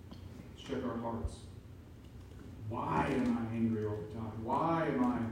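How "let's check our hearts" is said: much softer and slow; softly urging the congregation to look inward; hushed, reflective. Real, not acted. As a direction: invite quiet soul-searching. Soft invitation to reflect.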